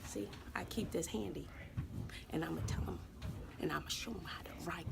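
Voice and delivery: unvoiced scheming whisper; secretive whisper. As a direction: whisper it to yourself. Aside about teaching.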